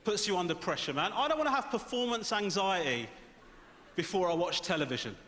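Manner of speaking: ordinary talk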